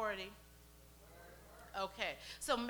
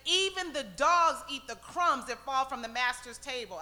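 loud, forceful acted retort; insistent plea; 8 dB louder than usual. Canaanite woman argues for crumbs from the table, loudly insistent. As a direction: press the case at full voice.

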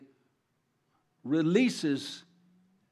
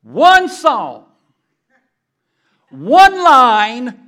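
much louder, higher sermon cry; full-force preaching; 5 semitones higher, 13 dB louder, much slower than usual. Acted. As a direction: proclaim it to the congregation.

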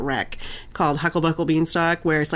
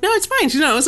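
higher, breezy reassuring mom; mock reassurance; 9 semitones higher, 3 dB louder than usual. Mom telling her upset daughter it is fine. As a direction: wave it off lightly. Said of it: "And I'm like".

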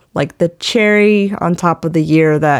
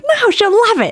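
much higher, breezy, sure of herself; Hava's confidence. Retold or imitated. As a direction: imitate an upbeat friend reassuring. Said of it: "she was like".

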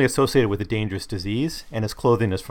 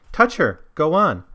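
high, louder urging; urging crowd; 7 semitones higher, 5 dB louder than usual. Acted. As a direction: egg her on, pitched up. Crowd urging in read quote.

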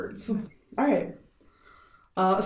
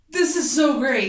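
excited; 6 semitones higher, 5 dB louder than usual. Real, not acted. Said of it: "I'm like".

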